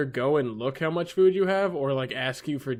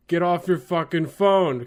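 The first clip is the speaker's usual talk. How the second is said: mildly raised, slowed mock yell; retold yell; 4 semitones higher, 5 dB louder than usual. Retelling a yell at a cyclist, mock shout. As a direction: yell it out, held back for storytelling. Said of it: "I just yelled out the window".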